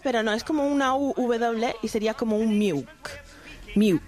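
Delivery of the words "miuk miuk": milk said as "miuk", the L turned to a U; "Miuk" with no L at all; vocalized L. Shown as a demonstration. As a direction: round the L into a U: "Miuk". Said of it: "se pronuncia como, es una especie de W".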